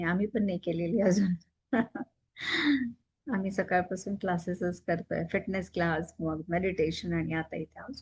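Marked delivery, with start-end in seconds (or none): 2.4-2.9 small self-amused laugh; light laugh. Real, not acted. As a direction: chuckle briefly at your own admission. Joking about not having bathed.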